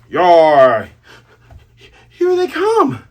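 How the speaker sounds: loud, high pirate war cry; pirate battle yell. Acted. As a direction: roar it as the horde charges. Pirates charging, calling out.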